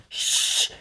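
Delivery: urgent whispered shush; frantic shush. A narrator's reading. Toji hushes the noisy kids, urgent whispered shush. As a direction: hush them fast. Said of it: "Toji made frantic gestures".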